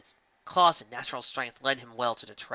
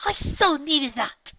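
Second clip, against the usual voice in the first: high, slow, delighted cry; gleeful; 7 dB louder, much slower than usual. A narrator's reading. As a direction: savour the triumph. Gleeful exclamation.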